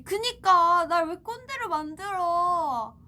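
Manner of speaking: loud, high, drawn-out whining protest; mock outrage. Idol protesting being made a kkondae, mock outraged shout. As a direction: wail it at them in playful outrage.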